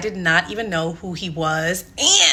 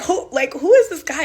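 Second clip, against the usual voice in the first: high-pitched, loud disbelief; baffled, high and punchy mock amazement; incredulous; 9 semitones higher, 4 dB louder than usual. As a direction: retell your own astonished reaction, pitched way up. Acts out own astonished reaction.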